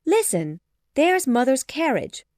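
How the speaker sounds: loud, rising to high pitch; excited cry. A narrator's reading. Jane hears her mother arriving, excited cry. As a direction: call out eager news. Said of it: "cried Jane".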